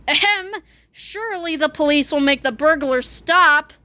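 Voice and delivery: usual speaking voice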